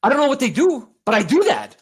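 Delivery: lightly voiced cocky actor, shrugging it off; breezy, earnest movie-star confidence; Mark Wahlberg impression. Keeping up the Wahlberg bit for his co-host. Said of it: "just somebody who looks like Mark Wahlberg".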